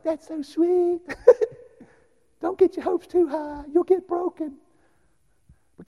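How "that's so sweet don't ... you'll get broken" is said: high, sugary mock of a doubting lady. Lady brushing off his seminary dream, high mock voice. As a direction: talk down sweetly like a doubter. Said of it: "She was like".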